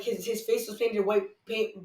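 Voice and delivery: ordinary talk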